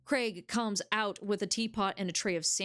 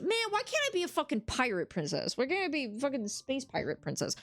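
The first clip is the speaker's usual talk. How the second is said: mock outrage; 6 semitones higher than usual. Real, not acted. Frustrated rant over choices.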